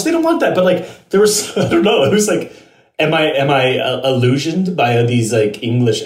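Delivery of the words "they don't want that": high, sneering mock of the producers; mocking mimicry. A host mocking the show's producers, high and sneering. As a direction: pitch it way up and sneer it.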